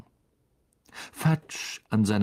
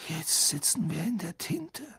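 higher, soft, husky dismay; hoarse; 8 semitones higher, 5 dB quieter than usual. A narrator's reading. Boy realising they are in trouble, hoarse. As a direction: squeeze it out hoarsely under the breath. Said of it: "sagte er heiser".

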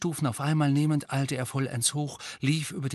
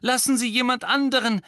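urgent call; 12 semitones higher, 6 dB louder than usual. A narrator's reading. Harry urging Mrs Weasley.

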